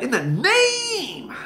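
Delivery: high, long-stretched NAME; mock a healer's mannered prayer, stretching it out high; fake spiritual preacher. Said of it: "to make it sound spiritual".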